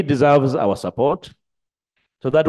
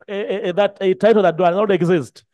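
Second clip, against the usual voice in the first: ordinary delivery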